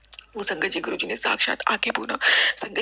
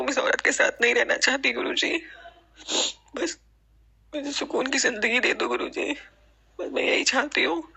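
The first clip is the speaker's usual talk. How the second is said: emotional plea; 4 semitones higher than usual. Real, not acted. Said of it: "मन बहुत emotional होता है".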